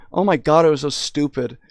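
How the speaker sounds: normal talking voice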